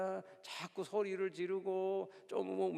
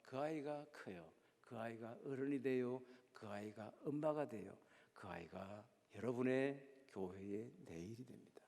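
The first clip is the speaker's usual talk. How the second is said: fading to a whisper; 8 semitones lower, 9 dB quieter than usual. Acted. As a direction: voice drops lower and softer line by line until a whisper. Preacher fading to a hush. (점점 낮아지다 속삭이는 목소리)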